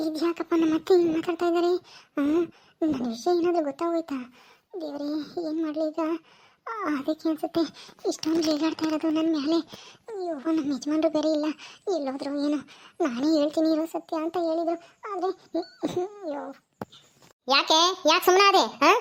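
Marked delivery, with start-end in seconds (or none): 0.1-14.8 softer, worried inner voice; daughter-in-law's private fear of being found out, softer; inner thoughts. Acted. 15.1-16.4 softer, slow sob; daughter-in-law despairs in her thoughts, slow and crying. Acted. Inner thought breaks into crying.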